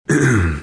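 short throat-clear. A narrator's reading. Seth composing himself before work, clearing his throat. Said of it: "He cleared his throat".

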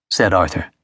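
usual speaking voice

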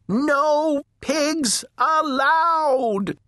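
very high, long drawn-out shout. A narrator's reading. Eugenia bellows at the pig she is chasing, high shout. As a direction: holler each word slowly, pitched right up. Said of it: "Eugenia shouted".

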